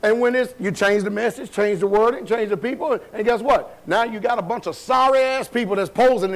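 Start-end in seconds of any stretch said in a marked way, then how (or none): none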